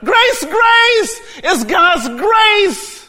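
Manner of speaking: high, shouted sermon climax; full-force preaching. Acted. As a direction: build to a loud, fervent proclamation.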